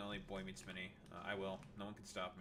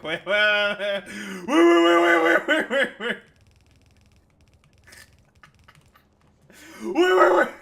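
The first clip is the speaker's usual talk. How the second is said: very high, very loud squawked repeats; mock alarm; 9 semitones higher, 23 dB louder than usual. Streamer reacting to a possibly transphobic post, blaring mock alarm. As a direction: blare it out like a siren.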